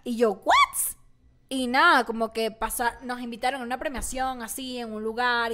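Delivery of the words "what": high squeaked "what?"; shocked what. Retold or imitated. Retelling her nomination to friends, shocked squeal. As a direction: squeal it in disbelief.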